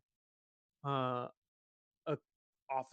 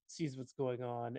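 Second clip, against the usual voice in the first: ordinary delivery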